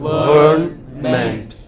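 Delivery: "Government" drawn out very slowly; slow teacher model of "Government"; slow syllable drill. Shown as a demonstration. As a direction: stretch out each syllable of "Government". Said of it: "put the stress on the first".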